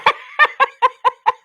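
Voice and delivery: loud, high-pitched cackling laugh; real laugh. Real, not acted. Vlogger cracking up at her own Uno joke, laughing. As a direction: crack up in a run of high bursts.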